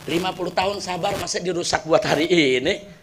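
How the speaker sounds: hushed, sorrowful prayer to himself; quiet, grieving lament; sad. Acted. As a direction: murmur it low, heartbroken and regretful. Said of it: "hatinya sedih".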